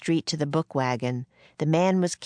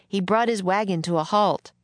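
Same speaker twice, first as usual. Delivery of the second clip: ordinary delivery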